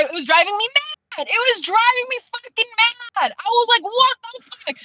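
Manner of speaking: high-pitched, worked-up exasperation; exasperated outburst. Retold or imitated. Reader recounting a maddening story, exasperated. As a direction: let the frustration push your pitch up.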